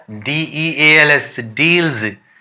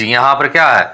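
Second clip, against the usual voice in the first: ordinary delivery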